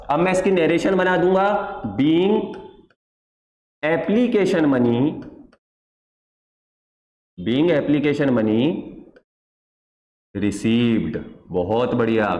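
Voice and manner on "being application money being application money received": very slow, with long pauses between phrases; slow dictation. Shown as a demonstration. Teacher dictating the narration to students copying it down, slow and spaced. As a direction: spell it out phrase by phrase for students to copy. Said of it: "बहुत आसानी से pause करके copy करते रहना".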